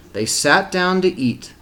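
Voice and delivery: ordinary delivery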